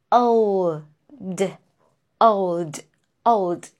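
put-on old said slowly with dark L and a clear D; careful old drill. Shown as a demonstration. Teacher drills old for students, slow and careful. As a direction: stretch out old, then close on the D. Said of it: "So this is".

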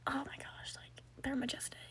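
whispered, starstruck; awed whisper. Retold or imitated. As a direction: whisper in total awe. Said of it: "I'm just like".

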